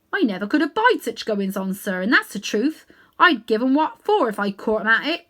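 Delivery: a London cabby fumbling for words; cockney cabby. Acted. Cabby answers the great lion, humble cockney voice.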